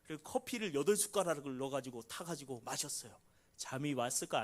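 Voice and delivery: usual speaking voice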